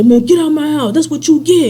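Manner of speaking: high-pitched mock yell; imitated angry order. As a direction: act out someone throwing guests out. Acts Darnell ordering them out.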